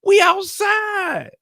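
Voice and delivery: slow, high, stretched mock shout; hammed-up shout. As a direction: yell it across the wind. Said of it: "Use your outside voice".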